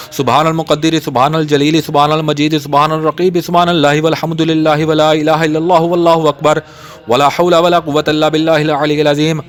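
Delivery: normal talking voice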